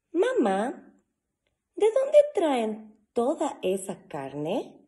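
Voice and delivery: put-on high, loud child voice, slow curious question; child Teo. Teo asking mom about the meat truck, high child voice. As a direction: ask like a curious little boy. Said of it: "pregunta Teo".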